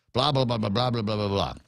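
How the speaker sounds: rattling off blah blah; dismissive blah. Real, not acted. Host skipping the bill's legal wording, dismissive. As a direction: wave it off quickly. Dismissing a long bill title.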